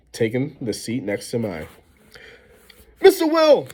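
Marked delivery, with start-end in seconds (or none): 3.0-3.7 sharp, high, loud call of a name; shout. A narrator's reading. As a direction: snap his name across the room. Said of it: "Miss Rubin shouted".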